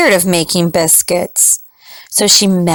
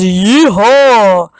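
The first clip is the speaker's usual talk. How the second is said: yee-haw shout; 4 dB louder than usual. Acted. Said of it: "shouted the javelinas".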